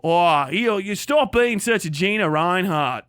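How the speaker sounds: hammed-up Aussie scolding; exaggerated Australian impression; mock Australian accent. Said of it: "Isn't that what you people say?".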